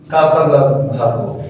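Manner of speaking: का, ख, ग, घ spoken slowly one by one; slow, deliberate teaching demo. Shown as a demonstration. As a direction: lay out का ख ग घ slowly for the class. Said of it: "इन सबको बोलने में मैं क्या बोल रहा हूँ".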